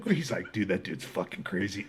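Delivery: low, soft imitated cellmate; low aside. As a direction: say it under your breath to your cellmate.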